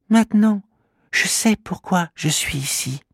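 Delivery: high, small creature's voice; a tiny being realising his purpose; little creature voice. Acted. Kimamila realising why he came, small high creature voice. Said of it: "dit le petit bonhomme".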